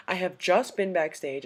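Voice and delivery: usual speaking voice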